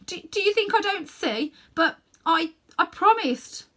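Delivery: put-on high, loud pained boy's voice; boy's voice torn and desperate; anguished boy. Diggory torn before the witch, high anguished plea.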